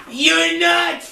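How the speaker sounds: high insistent shout. Acted. Denial rising.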